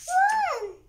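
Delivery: very high, loud shouted command; yell. A narrator's reading. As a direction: scream it out to get everyone running. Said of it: "yelled Chip".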